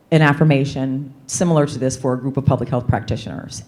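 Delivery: ordinary talk, nothing marked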